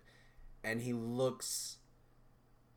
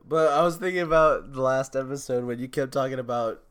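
ordinary talk, nothing marked

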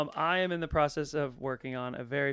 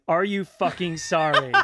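a louder, slower yell broken by laughter; laughing mock yell; 7 dB louder, slower than usual. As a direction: yell it at the goalie while cracking up. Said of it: "ended up yelling".

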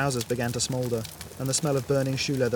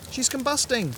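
a clearly higher call over the noise; shouting over the roar; 12 semitones higher than usual. Acted. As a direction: yell to be heard over the fire. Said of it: "Helen shouted over the roaring".

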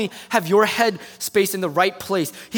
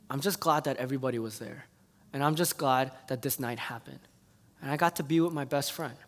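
softer, lower, gentle gratitude; tender reassurance; 5 semitones lower, 5 dB quieter than usual. Retold or imitated. Bride thanking her upset friend, soft and tender. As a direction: comfort a friend who feels bad. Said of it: "I remember she's like".

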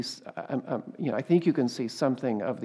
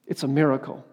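ordinary talk

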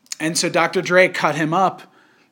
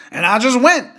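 high-pitched comedian impression; rough stab at a comedian's voice, pitched up; Dave Chappelle; 7 semitones higher, 3 dB louder than usual. Podcaster quoting the comedian's Africa story to a co-host, amateur impression. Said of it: "is that a good Dave Chappelle".

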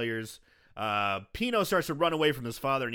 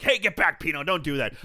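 loud, raised-pitch mock scolding; scolding yell; 2 semitones higher, 7 dB louder than usual. Store owner warning his son off, scolding loudly. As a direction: yell at the kid to back off.

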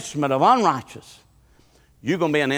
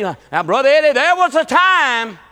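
slow, higher mock-proud churchgoer; 9 semitones higher, 6 dB louder, slower than usual. Preacher lightly mimics churchgoers boasting of past shouting. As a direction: drag it out like a bragging old saint. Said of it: "I know they say".

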